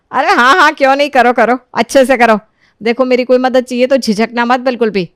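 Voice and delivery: loud, lifted, eager approval; hearty encouragement. Acted. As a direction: urge your daughter-in-law on heartily. Mother-in-law eagerly encourages her. (जोश से हौसला बढ़ाती सास)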